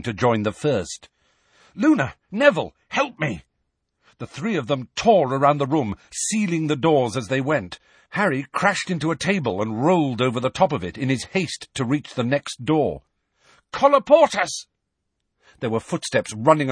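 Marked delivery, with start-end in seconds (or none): 1.7-3.4 high urgent shout; calling out. A narrator's reading. Harry calling friends urgently. 13.7-14.6 high, loud spell shout. A narrator's reading. Harry seals another door, shouting high.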